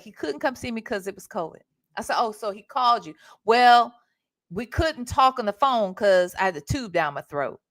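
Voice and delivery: ordinary talk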